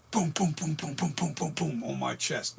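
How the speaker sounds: thumping boom boom, like a pounding heart; imitated heartbeat. Recalling his heart racing after the injection, imitated heartbeat booms. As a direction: mimic a racing heartbeat, slowing at the end.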